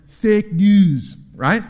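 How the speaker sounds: loud, drawn-out presidential impression; Donald Trump. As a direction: attempt a slow, punchy politician's catchphrase. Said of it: "that's my best Donald Trump impression".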